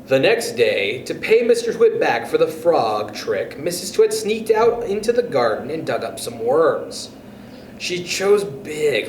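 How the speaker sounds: ordinary delivery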